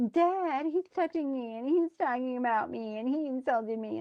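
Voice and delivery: put-on tattle to Dad like a little kid; imitating a squabbling child; tattling children. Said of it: "now they're suddenly like children".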